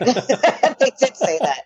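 high-pitched, drawn-out laughing agreement; amused and laughing through the words. Real, not acted. Laughs while agreeing about the quote.

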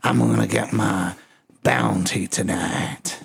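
low, slow, menacing villain rasp; Bane voice. Retold or imitated. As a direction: growl it like a masked villain. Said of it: "My uncle sounds like Bane".

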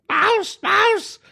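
very high falsetto mock yell; mock panicked yell. Podcaster mocking somebody yelling at a desk intruder, high mock yell. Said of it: "somebody yelling".

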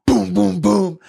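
loud, high booms; sudden loud pounding noises; imitated banging. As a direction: boom it out like fists on a window. Imitates banging on the truck window.

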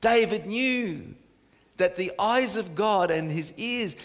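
ordinary talk, nothing marked

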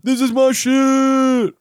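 high, slow "oh shit" delight; excited. Retold or imitated. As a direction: savor the discovery, drawn out. Imagined reaction after tasting.

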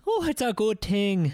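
put-on joking Irish accent between podcast hosts; bad Irish accent. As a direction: ham up a clumsy Irish brogue. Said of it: "really know how to do an Irish accent".

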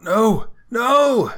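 loud, high-pitched wail of protest; crying out. Acted. Game master as Rogar protesting, high loud cry. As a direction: cry out at the top of the voice. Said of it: "Rogar cries out".

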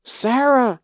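high, loud wail of a name; anguished scream. A narrator's reading. Mother screaming for her daughter at the block, anguished wail.

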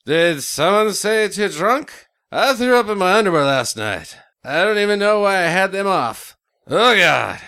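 slurring hungover partier; drunk voice. Acted. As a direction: play a sloppy drunk. Drunk Cinco de Mayo character.

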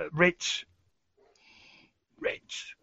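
hushed, hoarse rasp, barely voiced. Ghoul posing as a sore-throated human asks a cabbie, rasping. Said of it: "in my best rasping voice".

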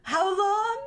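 mock Spanish-accented question, laughing through it; imitating a fake Spanish accent. Podcast host mimics Miss Fisher's bad Spanish accent. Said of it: "Spanish accent".

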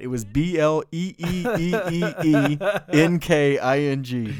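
spelled-out Bloomfield "bleenking"; spelling the accent. Shown as a demonstration. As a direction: spell it with long stretched Es. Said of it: "there was no I in that".

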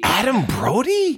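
high, slow disbelief; incredulous. Real, not acted. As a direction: ask it in total disbelief. Incredulous at friend's crush.

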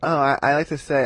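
ordinary talk

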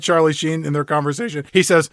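ordinary delivery

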